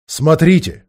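loud alarmed cry; shouting. A narrator's reading. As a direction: cry out a warning. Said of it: "вскричал он".

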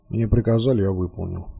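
low, subdued grumble; grunted. A narrator's reading. Colonel grudgingly answering his captor, grunted. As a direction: grunt it softly, pitch sinking low. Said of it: "буркнул".

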